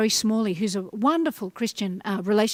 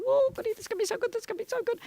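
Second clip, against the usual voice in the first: giddy anticipation; 11 semitones higher, 6 dB quieter, much faster than usual. Retold or imitated. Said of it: "you're thinking".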